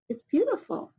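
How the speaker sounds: ordinary delivery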